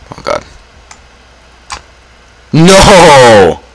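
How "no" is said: loud, high yell of defeat; real frustrated shout; anguished no. Real, not acted. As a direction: cry out as it all goes wrong. Losing in the game.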